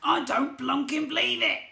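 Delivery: high, loud furious goblin scream. A narrator's reading. Goblin prince seeing the sea, high furious scream. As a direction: shriek it in outrage. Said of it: "he screamed".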